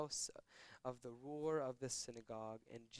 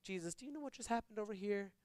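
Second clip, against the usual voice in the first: higher-pitched, mock-baffled question; incredulous onlookers; 8 semitones higher than usual. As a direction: play a bewildered crowd questioning him. Imagined crowd questioning Jesus, incredulous.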